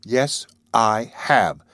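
slow, measured yes; slow answer. Acted. Maud answering Wolf Larsen's challenge, slowly. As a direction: take your time over each word. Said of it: "she answered slowly".

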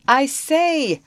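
high, loud hail; calling out. A narrator's reading. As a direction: shout for their attention. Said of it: "called out the man".

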